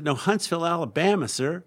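put-on high Southern-accented correction. Clerk correcting a confused customer, Southern accent. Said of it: "with a very deep Southern accent".

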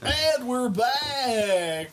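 high, very slow announcer call. Acted. Host announcing the show is back from a break. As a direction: stretch it out like a radio host.

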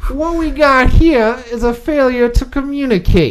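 Southern drawl. Acted. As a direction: drag out a Southern lawman accent. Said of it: "going off of that, that accent".

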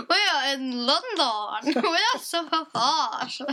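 posh London accent through laughter; hammed-up posh chortle. Shown as a demonstration. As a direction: guffaw like a London toff. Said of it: "can you do a posh accent".

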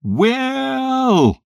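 loud high call across the room. A narrator's reading. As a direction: call his name with all your strength. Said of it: "Then I called with all my strength".

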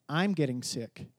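low, soft and slow, like an inner voice; devil's whisper in first person. Retold or imitated. Preacher voicing the devil's thought, low and soft. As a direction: murmur it as your own thought. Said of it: "He'll put it in first person".

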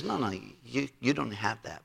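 low, soft and dismissive; a king's hushed, firm no. Retold or imitated. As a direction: quietly turn him down, no room to argue. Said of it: "And the king says".

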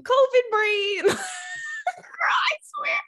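high laughing call of the name. Retold or imitated. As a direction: squeal the name through laughter. Calling the cat, laughing.

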